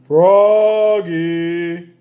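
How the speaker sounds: loud, drawn-out call; long loud shout; yelling. A narrator's reading. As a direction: yell down the hall as Dad. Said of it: "yelled Dad".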